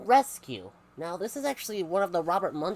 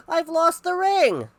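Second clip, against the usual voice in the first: high, loud and slow wail; panicked shout; 14 semitones higher, 10 dB louder, slower than usual. Acted. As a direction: cry it out in despair. Man shouts about lost ring.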